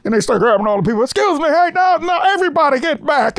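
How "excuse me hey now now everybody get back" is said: high-pitched, urgent crowd-clearing calls; imitating urgent security. Security running in to grab people, urgent. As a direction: order people back in a raised, strained pitch.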